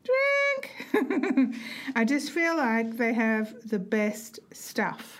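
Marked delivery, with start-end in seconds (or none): 0.0-1.5 high, drawn-out "drink" with a laugh; playful drink call. Pretend. Co-hosts playing a drink game, playful high-pitched call. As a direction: sing it out very high and long, breaking into laughter.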